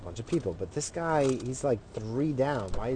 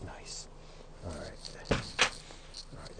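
normal talking voice

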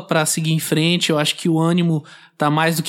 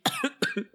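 high choking cough; 10 semitones higher, 11 dB quieter than usual. Real, not acted. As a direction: hack on a drink. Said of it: "Eu me engasguei".

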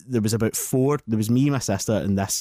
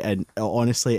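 ordinary delivery

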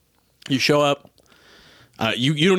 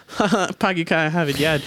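mock Boston accent done badly, pitched higher and laughing; 6 semitones higher than usual. Guy ordering coffee in a weak put-on Boston accent. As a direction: ham up a lousy fake Boston accent. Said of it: "it had to be a good Boston accent".

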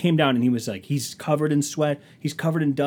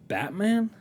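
high, rising disbelief; acted-out confusion, pitch swooping up; baffled question; 10 semitones higher than usual. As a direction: echo it back as a puzzled question. Baffled at misheard word.

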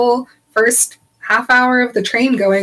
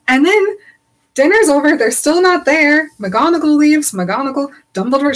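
rising indignation; 6 semitones higher than usual. Real, not acted. Building outrage in retelling.

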